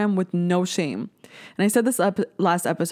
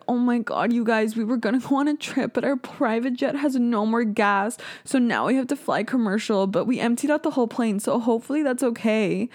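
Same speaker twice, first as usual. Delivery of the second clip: higher-pitched gushing vlogger imitation; mock-earnest YouTuber voice, pitched up; 5 semitones higher than usual. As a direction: parody an out-of-touch influencer, pitched up. Mocking out-of-touch YouTubers' vlogs.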